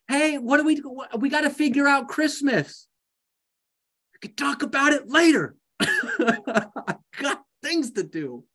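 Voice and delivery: pushy relative. Acted. Family member nagging about Christmas.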